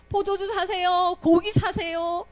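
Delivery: drawn-out, high, loud hawking; market vendor's call. Acted. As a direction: call out your wares, stretching each word. Acting market vendors calling out.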